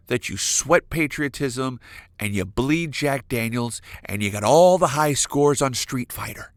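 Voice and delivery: ordinary delivery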